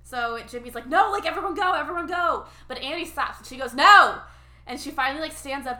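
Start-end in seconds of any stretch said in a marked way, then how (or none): 0.9-2.4 mock urging shout. Stubborn dad urging kids on. 3.7-4.2 loud, defiant refusal. Retold or imitated. Daughter stands up to dad.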